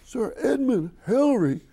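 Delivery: high and loud cry of delight; thrilled exclamation. Retold or imitated. A fan recognizing his hero, loud thrilled cry. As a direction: shout the name in pure thrill.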